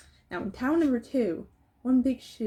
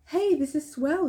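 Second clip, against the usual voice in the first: put-on higher, brighter boyish cheer; boy's delight; 7 semitones higher, 4 dB louder than usual. A narrator's reading. Boy marvels at the valley, excited. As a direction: lift it up with boyish wonder. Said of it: "he said".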